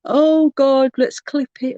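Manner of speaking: mock the gloating trolls. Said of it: "the trolls will be there going".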